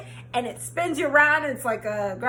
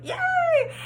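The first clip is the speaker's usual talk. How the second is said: squealed yay. Retold or imitated. Retold cheer.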